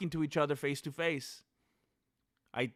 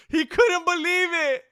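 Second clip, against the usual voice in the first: mock shout; 12 semitones higher, 8 dB louder than usual.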